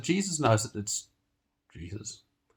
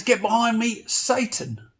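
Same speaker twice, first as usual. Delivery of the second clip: higher, firm rebuke; riled up; 7 semitones higher than usual. Acted. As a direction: push him away with the words. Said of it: "he gets pretty riled up".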